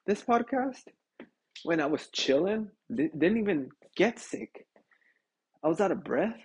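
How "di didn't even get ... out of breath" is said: usual speaking voice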